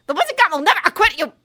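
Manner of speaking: high, very loud furious threat; furious shout. A narrator's reading. Umbridge furiously shouting at Bill and Ron.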